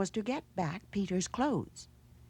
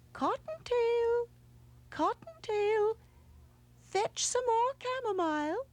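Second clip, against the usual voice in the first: calling aunt's voice. A narrator's reading. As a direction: sing out high from down the hole to fetch her child.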